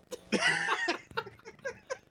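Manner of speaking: high-pitched squealing laugh; fangirl squee. Real, not acted. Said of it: "a British man squeeing like a fangirl".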